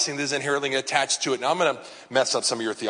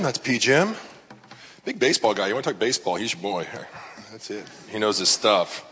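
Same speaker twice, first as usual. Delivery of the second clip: ordinary delivery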